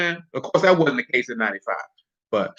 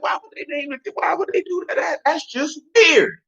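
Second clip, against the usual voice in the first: baffled protest; 12 semitones higher than usual. Retold or imitated. Acts out own baffled reaction.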